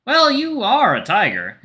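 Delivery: "Well, you are a tiger" high, loud and drawn out; teasing emphasis. Acted. Friend teasing the tiger, "you are a tiger" with high, drawn-out emphasis. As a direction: lean slowly and loudly on "are" in "you are a tiger".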